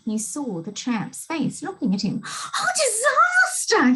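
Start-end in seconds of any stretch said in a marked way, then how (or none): 2.3-3.9 high, slow, terrified wail of "disaster"; panicked scream. A narrator's reading. Mister Jelly panics at the tramp's face, high terrified scream. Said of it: "he screamed".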